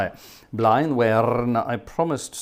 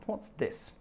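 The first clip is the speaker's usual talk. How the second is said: breathy whisper to himself, barely voiced; a private, curious whisper while reading a new message; whispered aside; 11 dB quieter than usual. Real, not acted. Reading a new message to himself, whispering.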